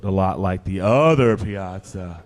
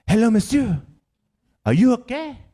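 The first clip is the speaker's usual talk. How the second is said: put-on high-pitched little Frenchman with French accent; squeaky-high, polite French accent. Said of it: "the little guy came out in his little French outfit".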